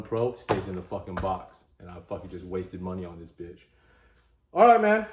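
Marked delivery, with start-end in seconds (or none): 4.5-5.1 loud, high, fed-up blurt; exasperated outburst. Real, not acted. Gun owner venting at the camera, loud and exasperated. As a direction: burst out, done with it. Fuming over stripped screw.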